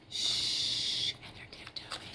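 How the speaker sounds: breathy hush with no voice; whisper. Shown as a demonstration. Teacher shushing for a sleeping baby, whispered. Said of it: "if there's a baby sleeping".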